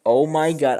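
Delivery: shocked exclamation. Retold or imitated. Chess player reliving his blunder, loud shocked outburst. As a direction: blurt it out in dismay. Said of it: "And I go like".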